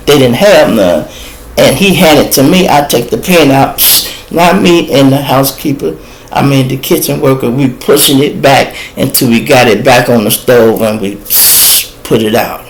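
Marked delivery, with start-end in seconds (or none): 3.8-4.3 breathy psh of a fire extinguisher; spray noise through the teeth; extinguisher hiss. Retold or imitated. 11.2-11.9 breathy psh of a fire extinguisher; spray noise through the teeth; extinguisher hiss. Retold or imitated.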